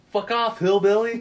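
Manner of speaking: high, loud sneering snap; mock insult. Retelling the film, Chad insults the hillbilly in a high sneering yell. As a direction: spit it out like a jock. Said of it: "Chad goes".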